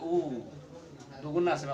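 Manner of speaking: उ held out very slowly, twice as long as अ; long vowel demo. Shown as a demonstration. Teacher shows pupils a two-beat उ, slowly. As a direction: stretch उ slowly to show double length. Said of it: "इसको बोलने में ज़्यादा समय लगेगा".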